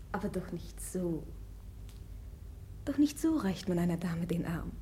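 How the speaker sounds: low unvoiced teasing whisper. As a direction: whisper it close to his ear, mocking. Teasing the prince privately.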